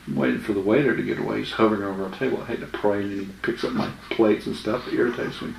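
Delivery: softly, as if the waiter might overhear; retold hushed reply. Tells his friend quietly why he waits to say grace. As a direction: keep it down, a quiet aside at the table. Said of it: "I said".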